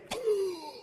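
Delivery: sharp high gasp of shock. Pretend. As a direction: gasp as if the news stuns you. Shocked reaction to the news.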